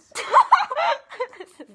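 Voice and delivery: loud laughing fit; burst of laughter. Real, not acted. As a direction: crack up out loud. Blooper laughter.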